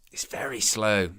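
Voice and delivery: slow, breathless whisper of frustration. Real, not acted. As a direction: mutter it under your breath, slowly.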